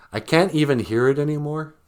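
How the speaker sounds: ordinary delivery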